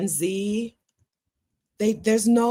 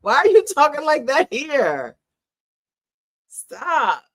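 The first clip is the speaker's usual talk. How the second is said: mock scolding; 8 semitones higher, 4 dB louder than usual. Scolding younger men directly.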